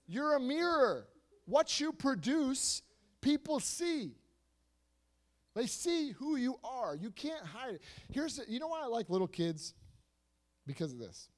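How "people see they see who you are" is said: ordinary talk, nothing marked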